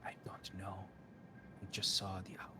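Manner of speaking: a cryptic oracle studying a stranger; a hint of an otherworldly prophetess; eerie seer woman.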